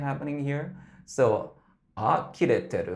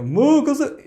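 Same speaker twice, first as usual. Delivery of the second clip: loud, much higher dark belt on Makase; 12 dB louder than usual. Shown as a demonstration. Coach shows the wrong dark belt on Makase. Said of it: "it doesn't go like dark belt".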